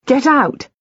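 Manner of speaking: frightened cry to leave; panicked order. A narrator's reading. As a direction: drive them from the room. Panicked shouting at visitors.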